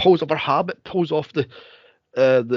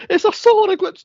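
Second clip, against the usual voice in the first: hammed-up, much higher and louder; mock hysterical nun; 7 dB louder than usual. Retelling the nun's freakout in a high, loud mock voice. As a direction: cry it out like a nun losing her mind.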